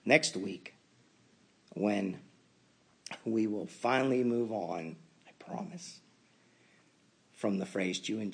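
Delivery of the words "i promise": whispered little promise; whispered aside. Real, not acted.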